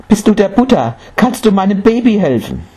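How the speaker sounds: high, desperate mother's cry; urgent plea. A narrator's reading. Mother seeking the Buddha for her sick child, pleading.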